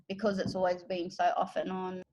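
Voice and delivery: ordinary delivery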